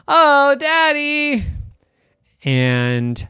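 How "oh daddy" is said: slow, high drawn-out whine; whiny protest. Acted. Girl whines at daddy about school starting. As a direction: groan at the news.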